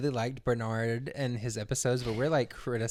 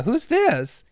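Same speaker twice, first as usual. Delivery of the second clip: imitated woman on the phone, higher and louder; lightly voiced Samantha; 10 semitones higher, 6 dB louder than usual. Samantha asks the caller back, higher imitated voice. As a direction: raise the pitch for her question. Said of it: "And she's like".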